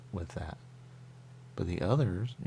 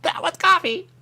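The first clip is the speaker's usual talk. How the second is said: much higher and louder startled cry; surprised outburst; 13 dB louder than usual. Real, not acted. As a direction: blurt it out as the taste hits you. Startled by coffee taste.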